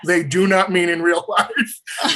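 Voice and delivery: slow, word-by-word insistence with a laugh; emphatic. Real, not acted. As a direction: spell it out slowly, amused. Repeats warning, listener laughing.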